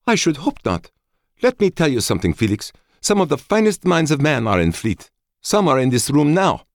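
high, forceful woman's voice; bitter woman. A narrator's reading. Woman defending the minds of Fleet to Felix, bitter. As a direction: lecture him indignantly, pitched high and loud. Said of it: "He met her bitter gaze".

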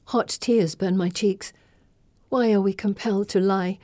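usual speaking voice

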